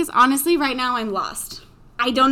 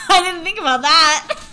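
high, loud laugh through the words; 6 semitones higher, 3 dB louder than usual. Real, not acted. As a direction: laugh in disbelief at yourself. Laughing at 5 a.m. idea.

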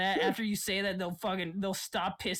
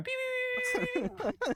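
car alarm death scream; 3 dB louder, faster than usual. Retold or imitated. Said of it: "his death scream would just be like the car alarm".

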